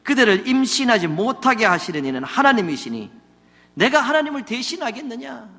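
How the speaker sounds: a husband snapping back at his wife; angry rebuke. Acted. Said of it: "성을 내어 이르되".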